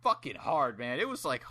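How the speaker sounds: usual speaking voice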